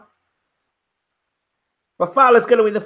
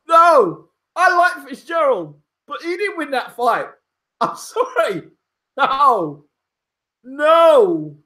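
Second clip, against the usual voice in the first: worked-up insistence; 6 semitones higher, slower than usual. Real, not acted. Insisting after shock result.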